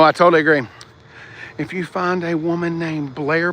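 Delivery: ordinary delivery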